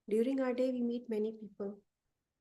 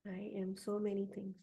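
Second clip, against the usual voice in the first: affirmation reading. Acted. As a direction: speak each affirmation gently, like a mantra. Leads listeners through spoken affirmations.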